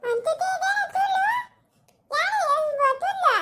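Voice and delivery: very high, squeaky comic voice. Acted. Comic character asking whose hair, in a very high squeak. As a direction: ham it up in a squeaky voice.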